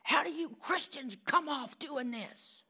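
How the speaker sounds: high mock angry professor. Preacher imitating an angry professor's mild mock outburst. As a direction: retell her outburst, a touch heated. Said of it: "she's so mad she's spitting".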